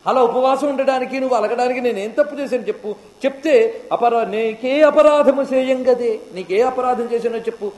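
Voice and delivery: high-pitched pleading with the Lord; devotee's plea. Acted. Devotee pleading with fasting god. (వేడుకుంటున్న భక్తుడి గొంతు)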